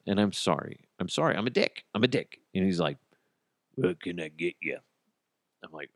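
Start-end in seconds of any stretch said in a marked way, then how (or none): 3.8-4.8 imitating ice cream man. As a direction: mimic the ice cream man's voice quietly. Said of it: "And he talked like this".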